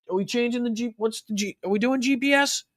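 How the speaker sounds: high, clueless questioning in a mock voice; confused, high-pitched mockery; Donald Trump. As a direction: impersonate a baffled politician, pitch well up. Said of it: "too fucking deaf to hear what he's saying".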